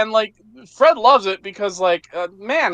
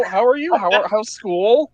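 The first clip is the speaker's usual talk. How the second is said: usual speaking voice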